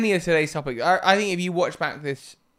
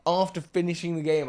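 usual speaking voice

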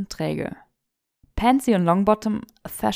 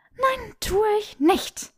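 groaning denial; 13 semitones higher, slower than usual. A narrator's reading. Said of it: "stöhnte Granger".

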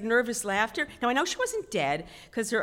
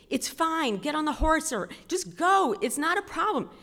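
higher-pitched insistent urging; impatient husband; 8 semitones higher, 3 dB louder than usual. Retold or imitated. Husband urging scared wife onto the horse, mock impatient. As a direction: imitate a husband pushing his wife to go.

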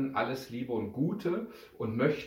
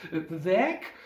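higher, startled question; taken aback, pitched up; dismayed echo; 7 semitones higher, 4 dB louder than usual. A narrator's reading. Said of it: "Pia musste sehr entsetzt ausgesehen haben".

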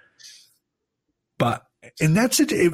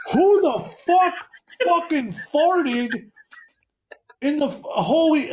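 mock dad yell. Retelling friend's dad calling from the kitchen, high mock yell. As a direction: imitate a dad hollering from far off, pitch way up. Dad yelling from upstairs, retold.

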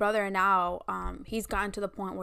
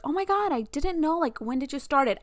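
mock surprise; 7 semitones higher than usual. Imagined friends reacting to podcast.

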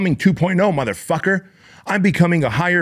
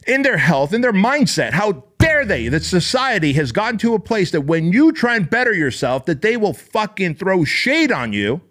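higher, indignant rant; indignant outrage; 6 semitones higher than usual. Real, not acted. Coach ranting at people who mock self-improvement, higher and indignant. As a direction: let the outrage at the critics climb higher.

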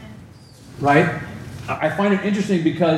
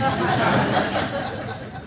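a quick airy laugh at his own joke; breathy chuckle; 9 semitones higher, 4 dB quieter than usual. Real, not acted. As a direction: let out a soft amused laugh. Pastor jokes about rubber taste.

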